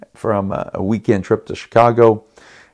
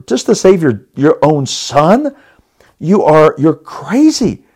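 higher, indignant retold outburst; mad disbelief; 4 semitones higher than usual. Husband furious at wife who won't lie to save their son. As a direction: hurl the disbelief at her. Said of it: "I was mad".

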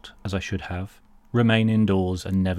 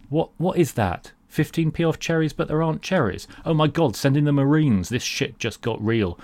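mock outrage; 6 semitones higher than usual. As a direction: play up the outrage. Mock outrage at cherry voucher.

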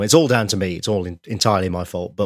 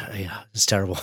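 whispered; 5 dB quieter, faster than usual. Real, not acted. Weary aside about renovation.

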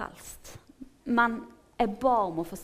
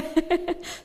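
short, loud high laugh; real laugh at oneself; amused laugh; 8 semitones higher, 6 dB louder than usual. Real, not acted. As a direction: burst out laughing mid-story. Laughs at being so specific.

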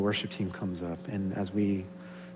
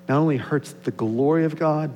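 ordinary talk

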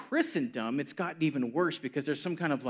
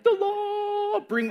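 high, eager cry of delight; excited; 4 dB louder, much slower than usual. Retold or imitated. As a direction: cheer for the law. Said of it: "And I'm like".

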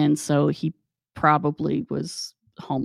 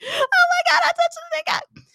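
extremely high mock wail; Lona. Coworker who touched the body, horrified squeal. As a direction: imitate a squealing, horrified woman. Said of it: "she was like".

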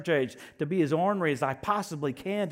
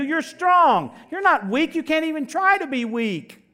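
much higher and louder, shouted proclamation; full-force preaching; 10 semitones higher, 9 dB louder than usual. Acted. Preacher proclaiming the church's strength, loud high shouting. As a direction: thunder it over the congregation.

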